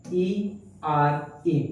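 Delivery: letters spelled out one by one, very slowly. Shown as a demonstration. Teacher spelling out the ending for students, slow letter by letter. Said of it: "किसी word के अंत में".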